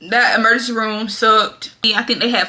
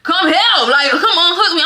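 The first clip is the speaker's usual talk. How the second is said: raised mock call for the nurse; retold calling out; 6 semitones higher than usual. Pregnant patient calling the nurse to unhook her, raised voice. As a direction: call out for someone to come, half-yelled. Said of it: "I'm yelling".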